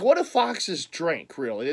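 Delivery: ordinary delivery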